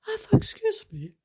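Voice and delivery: a shocked, high excuse me; indignant. Retold or imitated. Reacting to the stranger's remark, pitched high and indignant.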